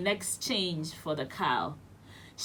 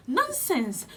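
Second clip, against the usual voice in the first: dismissive giant's wife; 6 semitones higher than usual. Acted.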